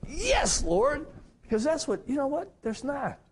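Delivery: very high-pitched rejoicing, not shouted; exultant agreement. Retold or imitated. Retold exultant inner agreement with wife.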